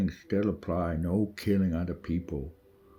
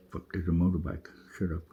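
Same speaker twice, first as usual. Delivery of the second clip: ordinary delivery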